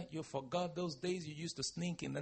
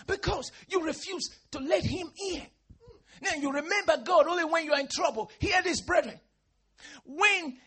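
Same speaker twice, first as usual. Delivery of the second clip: higher, loud, crying rebuke; preacher crying out at the faithless; tearful preaching; 12 semitones higher, 12 dB louder than usual. Real, not acted. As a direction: plead and scold through tears.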